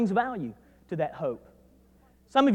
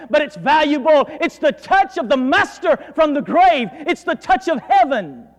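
louder, higher impassioned preaching; fervent pulpit preaching, louder and higher; full-force preaching; 5 semitones higher, 4 dB louder than usual. Acted. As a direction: preach it out, building to the climax.

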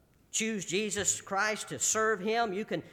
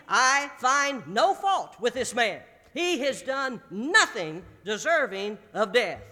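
louder, slow, weighty delivery of Pilate's verdict; emphatic slow quote; 4 semitones higher, 7 dB louder, slower than usual. Retold or imitated. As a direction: lean on every word, slowly.

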